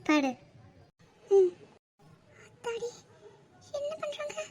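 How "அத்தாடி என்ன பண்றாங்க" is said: higher, much softer, half to herself; embarrassed aside. Acted. Wife caught out, muttering to herself in embarrassment. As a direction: mutter it under your breath, flustered.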